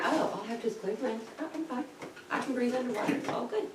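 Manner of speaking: high, light, soft self-talk; relieved realisation. Retold or imitated. Diver realising she can breathe underwater, high relieved self-talk. Acted realisation of being fine.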